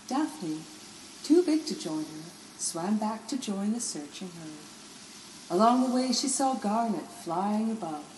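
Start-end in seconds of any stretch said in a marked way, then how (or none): none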